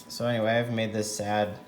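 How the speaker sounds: ordinary delivery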